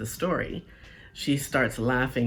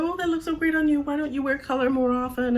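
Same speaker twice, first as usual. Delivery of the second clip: high, breathy-free sugary mock gush; mock gushing compliment; gushing coworkers; 10 semitones higher, faster than usual. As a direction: play the fawning coworker, way up high. Coworkers gushing over the dress.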